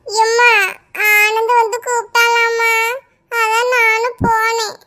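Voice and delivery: put-on a scolded kid sniffling out her excuse; whimpering child. Daughter whimpering to her angry mother.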